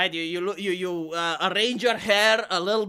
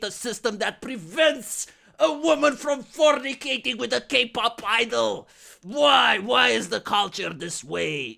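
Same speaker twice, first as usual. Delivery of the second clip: mock disgusted outrage; 4 semitones higher than usual. Creator faking disgust at a scandal for the audience, mock outrage. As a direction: rant in fake appalled disbelief, drawing out key words. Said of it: "genuinely saw this and he is disgusted".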